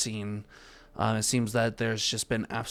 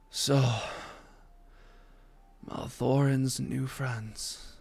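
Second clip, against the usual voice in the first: wounded figure speaking; 2 semitones higher than usual. Acted. GM voices collapsed wounded figure.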